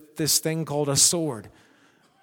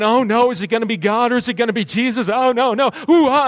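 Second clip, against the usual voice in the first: higher, louder, fast fretting like a nervous fan; mock nervous fan; 4 semitones higher, 4 dB louder, faster than usual. Preacher mocking believers who fear a close battle, hammed-up fan panic. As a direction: ham up a crowd sweating a close game. Mock anxious crowd at game.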